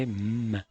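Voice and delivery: M as a humming mmm; letter sound M. Shown as a demonstration. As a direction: say M with closed lips, held. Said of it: "La bouche est fermée". (M prononcé « mmm », bouche fermée)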